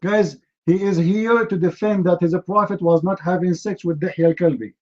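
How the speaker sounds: ordinary talk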